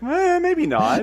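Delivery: drawn-out, high-pitched noncommittal dodge; mock reluctance. Friend jokingly voices Tasha declining to sleep, mock reluctant.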